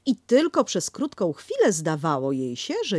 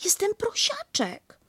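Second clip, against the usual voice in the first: much higher, slow squeak; proud little squeak; Piglet insisting. Acted. Piglet naming himself to Kanga, much higher.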